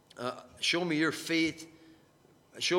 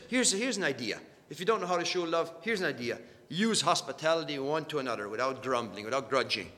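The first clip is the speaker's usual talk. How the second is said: ordinary delivery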